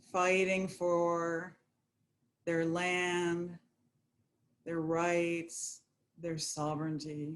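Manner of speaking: unhurried, even, calming guidance; guided meditation. Acted. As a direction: settle listeners gently, drawing out key words.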